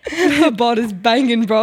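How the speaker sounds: slow, lightly done British lad; British accent. Pretend. Friend echoing the British bit, slow British accent. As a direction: drag it out like a mock British lad. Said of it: "really loud British".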